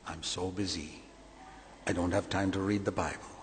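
a hushed, mocking take on the excuse; mock whiny excuse.

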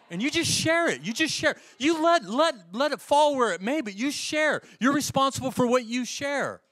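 high, loud, ringing preacher's call; full force preaching. Acted. Pastor urging the church to share, preached loud and high. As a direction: drive it home from the pulpit.

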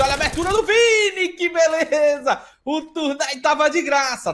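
high, loud burst, slow stretched words; excited play-by-play. Real, not acted. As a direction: pounce on the kill, savouring it. Narra abate ao vivo. (narração vibrante, palavras esticadas)

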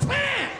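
high, shouted bam blast; a sudden explosion yelled out. Retold or imitated. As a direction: slam the word out like a blast. Mimics violent toilet blast.

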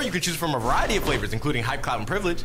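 normal talking voice

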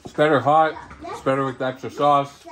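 chewing while talking; speaking between bites; mouth full of pizza. Real, not acted. Seen 3: Food at lips, then food visible in mouth while talking.

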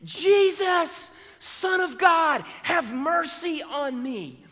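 loud high desperate cry, slowing on the plea; yelling out. Acted. Blind man calling out to Jesus from the roadside, desperate loud cry. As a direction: call out to be heard over a crowd. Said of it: "he begins to yell out".

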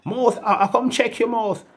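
high, pleading imitation of a beggar; mock beggar voice. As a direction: whine it up high like someone begging. Beggar quoted again.

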